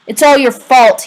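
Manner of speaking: accusing. A narrator's reading. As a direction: throw the blame at him. Rowdy accuses him.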